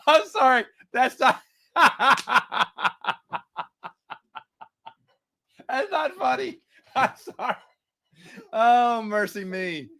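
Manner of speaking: high, breathless laughing apology; real laughing fit, eyes watering; laughing to tears. Real, not acted. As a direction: apologise while still cracking up. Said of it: "now I'm seriously crying".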